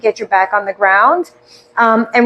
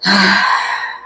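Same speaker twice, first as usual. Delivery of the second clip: long sighed-out "ahh"; deep open-mouthed exhale; audible exhale; 3 semitones lower than usual. Shown as a demonstration. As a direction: let the breath out in an audible, releasing sigh. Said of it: "let out those audible".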